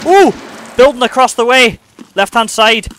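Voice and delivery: high, loud shouted warning; sharp alarmed call across the map; urgent callout. Real, not acted. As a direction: yell the enemy's position to the squad. Spotting enemy, calling out.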